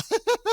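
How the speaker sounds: a high, loud squeal of laughter; high shrieking laugh. Real, not acted. Host cracking up at guest's closet story, high squealing laugh. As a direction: burst into a shrill cackle.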